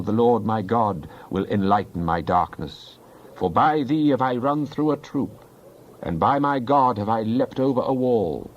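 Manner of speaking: ordinary talk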